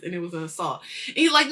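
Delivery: ordinary talk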